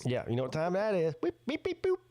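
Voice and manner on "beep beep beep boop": high beep, beep, boop; beeping noise. Pretend. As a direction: beep like an alarm clock. Imitates a beeping alarm.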